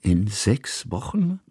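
slow, puzzled echo of the words; drawn-out questioning repeat rising at the end; repeating in disbelief. Acted. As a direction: echo it back slowly, unsure what he heard. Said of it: "wiederholte Kusmin".

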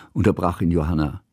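ordinary delivery

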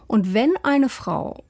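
WENN drawn out and emphasised, slow phrase; stress on WENN. Real, not acted. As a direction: lean deliberately on the word WENN. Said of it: "die Betonung liegt auf wenn".

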